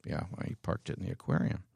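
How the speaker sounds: fast unvoiced whisper; hushed quick aside. Real, not acted. Joking aside, half-voiced.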